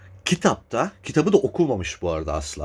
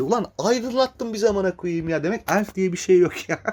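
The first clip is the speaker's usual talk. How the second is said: sarcastic mock thanks; 9 semitones higher than usual.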